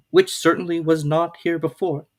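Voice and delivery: put-on a surprised little girl; Alice's voice. A narrator's reading. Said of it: "said Alice".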